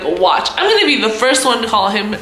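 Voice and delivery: ordinary delivery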